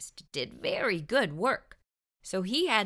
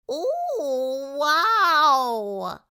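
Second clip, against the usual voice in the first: high, gasping surprise; shocked at the rotten tooth; gasped; 7 semitones higher, 6 dB louder than usual. A narrator's reading. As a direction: mouse gasps at bad breath. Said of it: "he gasped".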